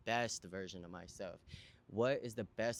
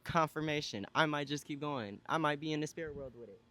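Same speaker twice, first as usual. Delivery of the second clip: higher and louder, rising excitement; fired up; 6 semitones higher, 5 dB louder than usual. Real, not acted. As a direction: declare it with growing fire. Building excited declaration.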